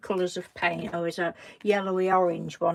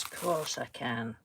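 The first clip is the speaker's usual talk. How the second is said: usual speaking voice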